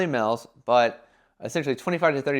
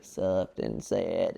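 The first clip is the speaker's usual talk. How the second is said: slow, soft, low mopey voice; soft and sad; 7 semitones lower, 5 dB quieter, much slower than usual. Shown as a demonstration. Showing what the brand tone must avoid, slow and mopey. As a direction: let it sink, slow and soft.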